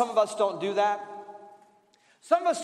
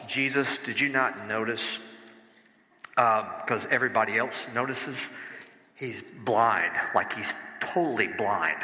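mock whispered aside; 12 semitones lower than usual. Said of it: "they kinda say".

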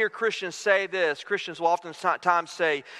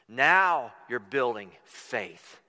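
low, very slow delivery; weighty and low, word by word; solemn pronouncement; 9 semitones lower, much slower than usual. Retold or imitated. The lesson's solemn payoff.

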